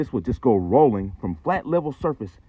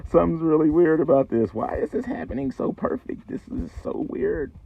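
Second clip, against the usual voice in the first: acted bewilderment; 7 semitones higher than usual. Acts own bewildered thoughts after crash.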